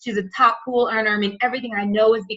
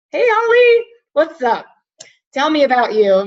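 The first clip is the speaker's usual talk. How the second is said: much higher, louder forced-cheery hello; awkward greeting; 4 semitones higher, 6 dB louder than usual. Retold or imitated. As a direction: overdo the bright hello to show the awkwardness. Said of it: "it's awkward".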